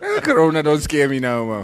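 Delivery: high, loud playful complaint; mock protest. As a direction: scold the virus in hammed-up exasperation. Acts out being tired of Corona.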